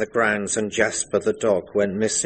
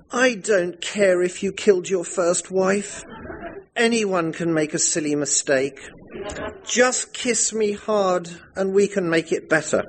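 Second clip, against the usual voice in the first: put-on much higher breathless girlish gush; swooning bride; 9 semitones higher than usual. The bride forgives her murderer husband, high girlish gush. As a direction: gush adoringly like a naive girl. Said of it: "I answered".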